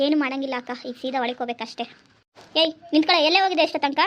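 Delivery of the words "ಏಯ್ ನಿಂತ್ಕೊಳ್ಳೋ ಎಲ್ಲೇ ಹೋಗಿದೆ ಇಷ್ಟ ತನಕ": loud, sharp mother-in-law scolding; mother-in-law yelling at her son's wife for coming home late; angry scolding. Acted. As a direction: bark at the late daughter-in-law.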